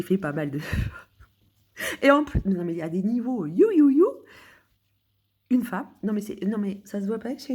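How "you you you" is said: mock cheer. Mock cheer after bragging.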